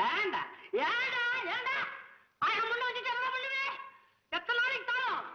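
high-pitched comic wail of reproach; scolding himself. Acted. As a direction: berate yourself, voice climbing high. தனியாக தன்னையே கடிந்துகொள்ளுதல். (தன்னையே திட்டும் உச்சக்குரல் புலம்பல்)

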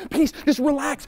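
high, frantic demand for peace; peace without calm. Acted. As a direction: plead for peace while badly rattled. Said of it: "speak that peace that you don't have".

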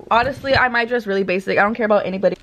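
ordinary delivery

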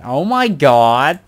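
very loud, high, drawn-out American exclamation; American accent. Acted. Asked for his best American accent, exaggerated American shout. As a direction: belt it out in a hammed-up American accent. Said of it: "Do your best American accent".

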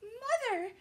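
high, loud frightened squeak; squeaky call. A narrator's reading. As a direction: squeak out for her mother. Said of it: "Stella Luna squeaked".

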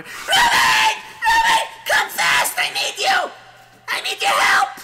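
frantic, very high-pitched shrieking. Acted. Wife needs husband's help fast, frantic screaming. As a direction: shriek for help at full volume. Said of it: "But she screams even louder".

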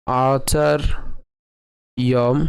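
আচার্যম drawn out very slowly, split as a-char-ji-om; আচার্যম ধীরে ভেঙে উচ্চারণ; slow pronunciation demo. Shown as a demonstration. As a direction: stretch আচার্যম syllable by syllable. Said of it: "কেমন হবে".

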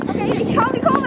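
high, loud call mid-ride; excited shout. Acted. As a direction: shout it out as you hit the curve. Shouting while taking the curve.

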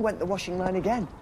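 put-on lively storybook reading for small children; bedtime story narration. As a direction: read it like a bedtime picture book.

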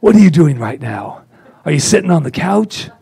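loud, high motivational coach voice; Tony Robbins pep talk. Retold or imitated. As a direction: fire up the couch potato at 2 AM. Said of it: "And there was Tony".

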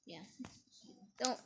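ordinary talk, nothing marked

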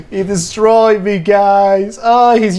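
high, loud laughing disbelief. Real, not acted. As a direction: say it still laughing. Laughing about getting destroyed.

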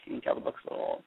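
breathy whisper, a side joke to the class; whispered aside. Real, not acted. Teacher's aside while reading.

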